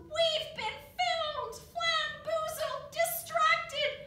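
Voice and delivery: high, loud alarmed yell. A narrator's reading. Dilly yelling to the other bugs, loud and high. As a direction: shout the warning to everyone. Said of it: "She yelled".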